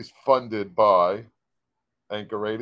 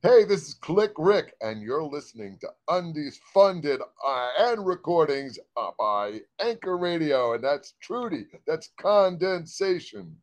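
radio announcer; 4 semitones higher than usual. Acted. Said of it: "recording a station ID take".

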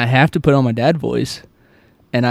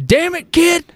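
much higher, sudden fed-up snap; exasperated outburst; slower than usual. Retold or imitated. A TikTok dad losing it with his son, high exasperated outburst. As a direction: let the patience crack all at once. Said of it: "he was like".

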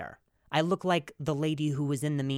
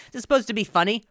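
higher, huffy mock repeat; mock commenter; 6 dB louder, faster than usual. Host mocks the commenter's line again, huffy. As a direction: repeat it as a snooty critic. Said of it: "the comment".

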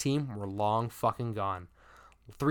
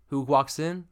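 usual speaking voice

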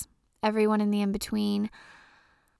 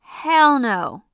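emphatic refusal; 4 semitones higher, 8 dB louder than usual. Real, not acted. Rejecting the imagined job.

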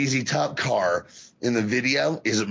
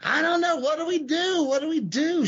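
mock panicked squeal, much higher; playing Jim's helpless panic, pitched way up; panicked screaming. Jim screaming to Stan in a panic, much higher. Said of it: "And Jim's screaming".

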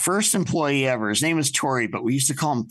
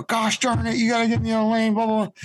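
exasperated backseat driver; 7 semitones higher, faster than usual. Retold or imitated. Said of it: "Larry's like".

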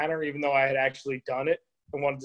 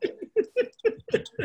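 shrieking burst of laughter; breathy high-pitched laugh; high squealing laugh; 5 dB quieter than usual. Real, not acted. Wild laugh at kid's retort.

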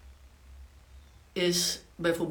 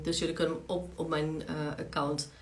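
ordinary talk, nothing marked

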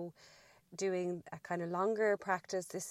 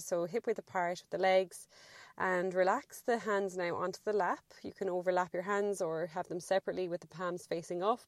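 usual speaking voice

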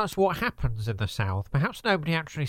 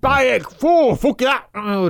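loud, higher exaggerated northern outburst; too northern; 10 semitones higher, 11 dB louder, slower than usual. Comedian overshoots into northern while fixing his voice. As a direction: blurt it out as a broad northern yell. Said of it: "bouncing around from south to north".

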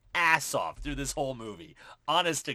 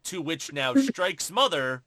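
ordinary talk, nothing marked

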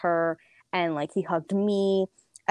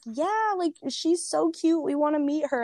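eager high parents; 10 semitones higher than usual. Retold or imitated. Dad and stepmom voiced eagerly.

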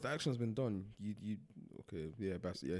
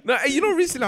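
high, loud laughing burst; 12 semitones higher, 9 dB louder than usual. Real, not acted. Laugh heard, higher and louder.

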